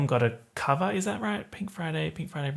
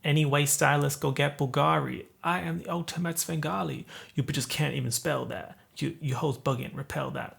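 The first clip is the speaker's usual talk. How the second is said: put-on American accent; 3 dB quieter than usual. YouTuber rapping the favourite song's lyrics, American accent. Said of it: "So she says".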